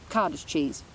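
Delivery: usual speaking voice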